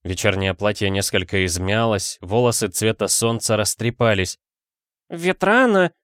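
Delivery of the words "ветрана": grandmother's high, alarmed cry; high-pitched exclamation of alarm. A narrator's reading. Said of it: "вскричала бабушка".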